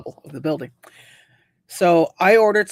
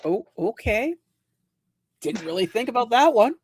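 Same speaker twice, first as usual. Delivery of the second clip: retold sheepish reply, pitched up; 6 semitones higher than usual. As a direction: act caught out, lilting higher. Caught out by supervisor, retold reply.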